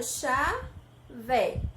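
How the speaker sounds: "Chá, vé" with a clear pause between the syllables; split into syllables. Shown as a demonstration. Teacher separates the syllables for the children, slowly and clearly. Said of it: "Eu separo".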